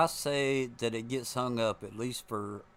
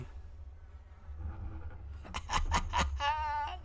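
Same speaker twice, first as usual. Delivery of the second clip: high breathy laugh. Real, not acted. Crash in game.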